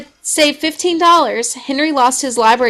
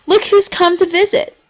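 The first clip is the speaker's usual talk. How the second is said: cheerful mom; 8 semitones higher than usual. A narrator's reading. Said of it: "Mom said".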